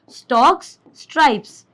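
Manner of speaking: stalks and stripes, opening s hit hard; stressing first sounds. Shown as a demonstration. Teacher showing alliteration, stressing the s in stalks and stripes. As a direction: punch the s at the start of stalks and stripes. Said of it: "स, स, first, first".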